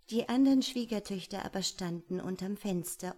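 plain reading voice

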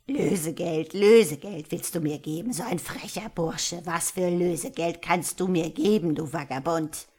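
loud, mocking hag voice; scornful old witch. A narrator's reading. Old witch scorning the boy's ransom, loud scornful witch voice. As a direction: throw the offer back with contempt.

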